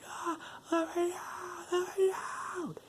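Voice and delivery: very high, slow falsetto wail; soft mock scream from someone trapped; imitated panicked cry. Chunk locked in a freezer.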